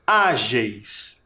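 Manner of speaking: Ágeis stretched on the Á, dropping on geis; stress on Á. Shown as a demonstration. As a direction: bring out the Á in Ágeis. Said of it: "Qual é a sílaba tônica?". (Ágeis destacando o Á tônico)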